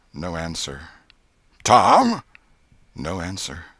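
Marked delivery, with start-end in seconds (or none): 1.6-2.2 louder, higher call. A narrator's reading. Aunt calling again for the boy, calling out. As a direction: call out sharply for someone out of sight.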